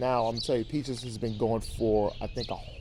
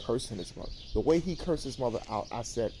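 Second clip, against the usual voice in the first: ordinary talk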